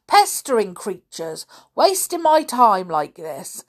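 put-on loud, cross old caretaker; angry scolding. A narrator's reading. Caretaker ordering pestering kids to clear out, angry. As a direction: tell them off loudly.

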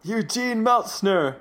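high, slow, drawn-out solemn announcement; hammed-up tombstone pronouncement; dramatic epitaph reading. Whit reading Eugene's own tombstone, slow mock-dramatic.

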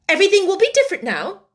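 fast, high, loud scolding; severe warning. A narrator's reading. Headmistress warns the orphan of change, severely. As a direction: rap it out sharply.

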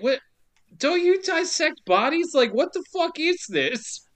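high-pitched baffled outburst; mock incredulous. Podcaster challenging the squeamish detective, mock incredulous. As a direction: question it in exaggerated disbelief. Mock disbelief at the film character.

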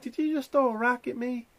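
high mock Karen question; mock accusing lady. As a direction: accuse him like the neighbor lady. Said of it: "She's like".

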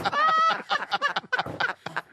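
very high, squealing burst of laughter. Real, not acted. Panelist cracks up at the host's wordplay, laughing. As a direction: crack up helplessly in a high pitch.

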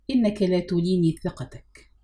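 ordinary delivery

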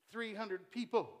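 ordinary talk, nothing marked

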